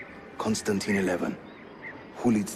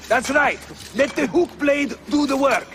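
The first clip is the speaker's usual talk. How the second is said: calling out; 9 semitones higher than usual. Real, not acted. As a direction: shout encouragement up to a climbing friend. Calling to Ezio during the climb.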